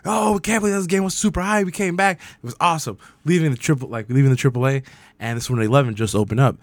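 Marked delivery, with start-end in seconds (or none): 0.1-3.0 retold hype. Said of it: "We're just fucking flipping".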